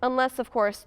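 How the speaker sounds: ordinary talk, nothing marked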